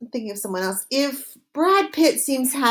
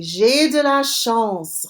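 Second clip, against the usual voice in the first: J'ai de la chance said slowly and clearly; slow model phrase; slower than usual. Shown as a demonstration. Teacher slowly modelling a phrase for students. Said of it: "Répétez".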